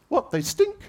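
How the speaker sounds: very high squeaky donkey. Retold or imitated. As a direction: squeak it in disbelief.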